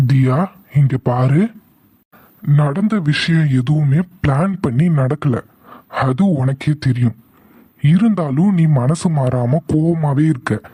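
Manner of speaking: ordinary delivery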